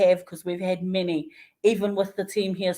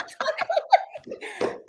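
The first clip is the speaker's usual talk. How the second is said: high, airy burst of laughter; breathy high laugh; 4 dB quieter than usual. Real, not acted. Amazed at the driver unloading everything, breathy laugh.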